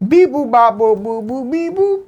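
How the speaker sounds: high chirpy robot beeps; toy robot voice. Pretend. Randomizer picking a winner.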